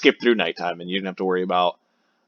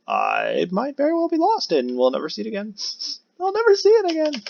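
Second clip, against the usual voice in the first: mock despair. Mock despair over lost house.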